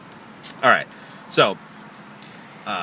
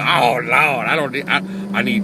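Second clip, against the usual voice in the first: horrible caricature; 3 semitones higher, 5 dB louder than usual. Retold or imitated. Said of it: "I'm sorry for the horrible caricature".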